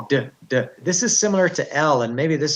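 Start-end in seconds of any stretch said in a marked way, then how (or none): 0.1-0.6 "D" as a quick "duh", cut short. Shown as a demonstration. Teacher contrasting D with long L, short D. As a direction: tap out "D" briefly, tongue dropping at once. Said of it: "it's short".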